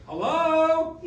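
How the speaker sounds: loud, high hello from far off; old man shouting at a phone; calling into phone. Acted. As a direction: grandpa greeting Mia through a held-away phone. Grandpa talking on held-away phone.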